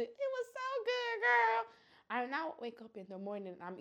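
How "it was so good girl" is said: gushing. Retold or imitated. Gushing to her cousin.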